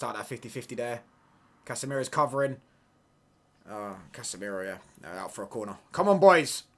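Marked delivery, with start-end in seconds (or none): 5.9-6.6 loud rallying cry at the team; urging shout. Real, not acted. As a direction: shout them on from the sofa. Cheering on the team.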